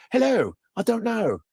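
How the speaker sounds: mock British accent; polite, hammed-up British voice. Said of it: "when you have a British accent".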